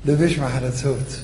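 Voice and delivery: ordinary talk, nothing marked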